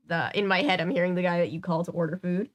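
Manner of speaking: ordinary delivery, nothing marked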